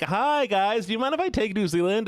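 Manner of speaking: high, cheery mock request; friendly greeting. Streamer asking Kiwis to hand over New Zealand, high and friendly.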